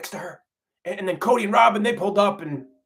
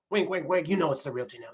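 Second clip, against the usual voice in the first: low, hushed wink-wink aside; sly aside; 5 semitones lower, 5 dB quieter than usual. Acted. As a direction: drop your voice, let them in on the secret. Wink-wink aside undercutting the disclaimer.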